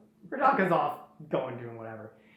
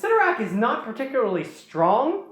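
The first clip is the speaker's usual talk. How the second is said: ordinary delivery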